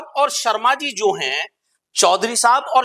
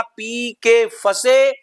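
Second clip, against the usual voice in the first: slow and deliberate; slow stress; 3 semitones higher, much slower than usual. Shown as a demonstration. Teacher slowly spelling out the memory trick. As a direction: draw the phrase out slowly. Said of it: "बस ध्यान रखना".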